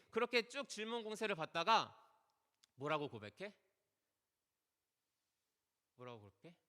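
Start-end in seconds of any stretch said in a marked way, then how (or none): 5.9-6.5 "뭐라고 고백해?" asked again much softer and lower, almost under the breath; soft, drawn-in rhetorical question; hushed repeat. Acted. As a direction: drop the voice right down for the repeat.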